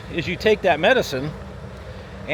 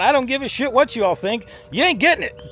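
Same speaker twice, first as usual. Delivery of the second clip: defiant medication; 8 semitones higher than usual. Acted. Medication voiced refusing the brain.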